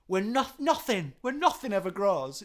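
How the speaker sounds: sarcastic lyric recital; mock solemn recital. Mock quoting song lyrics.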